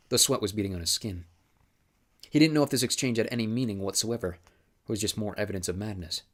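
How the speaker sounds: ordinary delivery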